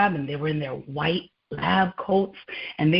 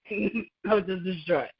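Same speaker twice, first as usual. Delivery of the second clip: laughing; 4 dB quieter than usual. Real, not acted.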